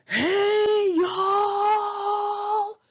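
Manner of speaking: imitating the loud Texan coworker hollering; drunk Texan shout. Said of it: "so loud it could shatter glass".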